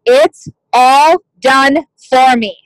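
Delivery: slow, word by word, for writing down; slow dictation. Shown as a demonstration. Team leader dictating a line to her reps, slowly. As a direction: dictate it slowly so they can copy it. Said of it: "I want you to write down".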